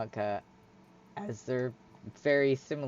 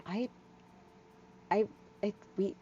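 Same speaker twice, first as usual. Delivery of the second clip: higher, halting, lightly voiced shy girl; flustered shy figure; 8 semitones higher, 9 dB quieter than usual. Hyla admitting she likes it, flustered and halting. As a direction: stammer softly through an awkward admission.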